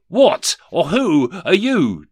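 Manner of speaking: high, loud, slow demand; demanding Scrooge. A narrator's reading. Scrooge confronts the ghost, high and demanding. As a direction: demand it loudly, drawing out each word. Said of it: "demanded Rishi Scrooge".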